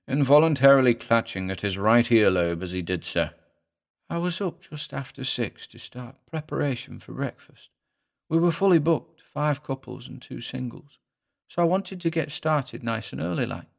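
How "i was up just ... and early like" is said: soft, higher-pitched little-man voice; David, reluctant little husband. Acted. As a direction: recount it meekly with a regional accent.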